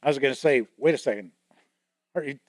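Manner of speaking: ordinary talk, nothing marked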